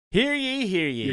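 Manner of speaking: high herald's call; mock royal decree. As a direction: announce like a town crier.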